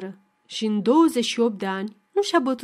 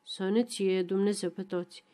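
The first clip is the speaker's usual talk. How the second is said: a brief, softer grumble into his drink; short mutter; 3 semitones lower, 6 dB quieter than usual. Acted. Old teacher toasting the drinkers, short soft mutter. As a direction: mumble it low and short, old man taking the bottle. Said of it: "care mormăie scurt".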